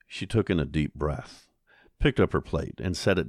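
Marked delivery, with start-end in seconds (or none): none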